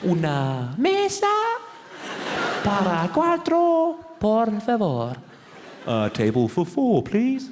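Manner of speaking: slow, high, over-enunciated Spanish; high slow teacher Spanish; language disc voice. Retold or imitated. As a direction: drill it slowly like a tape. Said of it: "goes again".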